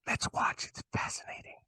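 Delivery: hushed nature-doc whisper; whispered documentary aside. Pretend. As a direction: whisper like a wildlife host. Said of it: "in a Steve Irwin voice".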